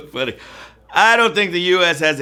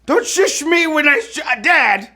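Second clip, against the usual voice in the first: higher and loud; indignant protest; 12 semitones higher, 4 dB louder than usual. Real, not acted. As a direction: protest being shushed. Protesting dad's shush.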